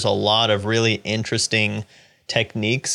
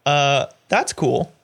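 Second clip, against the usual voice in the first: much higher, breezy unbothered voice; mock casual pointing out; 9 semitones higher than usual. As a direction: play it like a character shrugging at a prehistoric fish. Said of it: "they're, like, pointing out".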